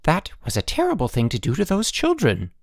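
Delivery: high-pitched wifely scolding. A narrator's reading. Wife takes headmaster to task over children, scolding. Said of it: "Missus Sturgeon scolded".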